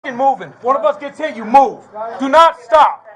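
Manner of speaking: urgent shout. Real, not acted. Rallying squad before entering.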